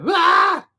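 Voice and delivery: high, loud shocked yelp; mock shocked scream. Drivers realizing the long-haired rider is a guy, mock shocked scream. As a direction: yelp as if caught off guard by a rude surprise.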